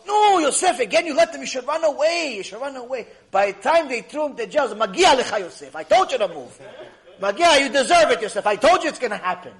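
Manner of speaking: loud, high exasperated telling-off; hammed-up I-told-you-so scolding; upset scolding. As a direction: imitate an old woman berating him. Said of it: "She's getting upset".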